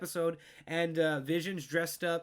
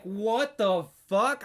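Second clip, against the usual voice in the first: stunned outburst; 4 semitones higher, 7 dB louder, much slower than usual. Retold or imitated. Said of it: "I was like".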